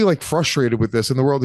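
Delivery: ordinary talk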